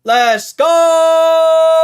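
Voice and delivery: high triumphant yell; excited shout. Real, not acted. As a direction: scream it in victory. Celebrating the trade hitting.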